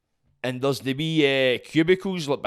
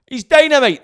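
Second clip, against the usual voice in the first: bursts out loud and high; excited shout; 12 semitones higher, 12 dB louder than usual. Real, not acted. Podcast host hyping his guest, excited shout. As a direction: shout the praise, thrilled.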